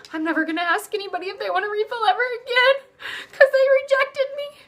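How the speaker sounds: high, whiny hammed-up waitress voice; mock rejected waitress. Mocking a waitress who quits over one no. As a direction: wail it like a hurt waitress.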